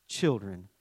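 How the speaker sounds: tender call. Acted. Jesus answering insult with tenderness, soft and low. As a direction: call them Children gently, like a father. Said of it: "He calls out".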